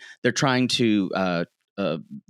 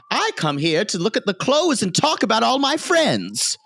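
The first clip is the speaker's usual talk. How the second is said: put-on higher, chatty old society lady; gossipy Mrs Fish; 10 semitones higher than usual. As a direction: gush like a gossiping matron. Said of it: "She's like".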